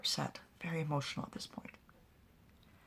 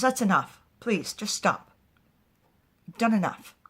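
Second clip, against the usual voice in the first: loud plea; 5 semitones higher, 9 dB louder than usual. Retold or imitated. Pilar pleads for it to stop.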